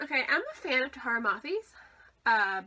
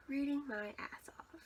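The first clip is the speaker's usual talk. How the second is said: whispering; 2 semitones higher, 9 dB quieter than usual. Real, not acted. Whispered cheeky aside.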